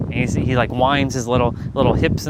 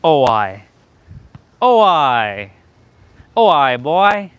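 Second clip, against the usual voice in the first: imitating a Caribbean exclamation, ramping up each time; mock Caribbean accent; 6 dB louder, much slower than usual. Vlogger mimics a viewer's patois, in a playful loud Caribbean accent. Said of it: "that's like Caribbean".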